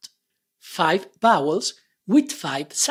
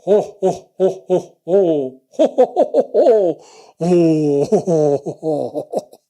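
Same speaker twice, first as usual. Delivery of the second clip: booming, louder ho-ho-ho; Santa Claus laughter; 5 dB louder than usual. Shown as a demonstration. Teacher demonstrating the O laugh, Santa ho-ho-ho. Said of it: "the laughter is the Santa Claus laughter".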